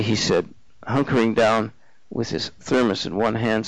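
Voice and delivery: plain reading voice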